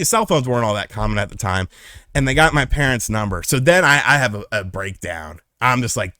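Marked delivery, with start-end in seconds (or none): none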